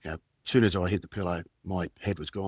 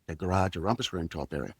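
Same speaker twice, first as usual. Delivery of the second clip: ordinary talk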